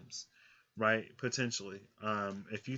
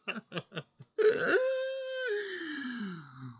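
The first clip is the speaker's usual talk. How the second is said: a shrieking laugh that breaks high; high cracking laugh; 5 dB louder than usual. Real, not acted. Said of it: "my voice cracked".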